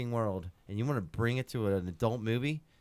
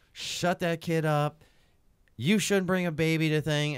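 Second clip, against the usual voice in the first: retold crowd yelling; 4 semitones higher, 4 dB louder, slower than usual. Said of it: "six or seven people just screaming".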